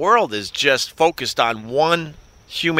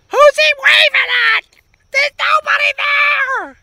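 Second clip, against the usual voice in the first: very high, loud mock shriek; imitated scream; 7 dB louder than usual. Host imitating the woman commenting on the video, screaming. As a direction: mimic a commentator screaming in disbelief, far above your own voice. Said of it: "And then she screams".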